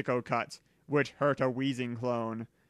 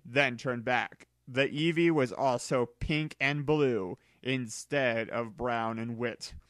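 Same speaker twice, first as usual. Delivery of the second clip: ordinary delivery, nothing marked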